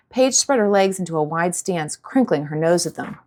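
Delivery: usual speaking voice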